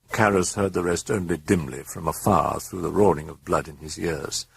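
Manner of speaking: usual speaking voice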